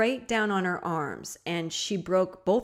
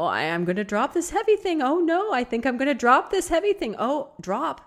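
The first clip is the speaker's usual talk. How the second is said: hammed-up panic climbing high and loud; mock alarm; 7 semitones higher, 4 dB louder than usual. Host acting out a normal person dropping a tent, mock panic. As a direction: play a comic fumble, pitch rising as it slips. Acts out dropping the heavy thing.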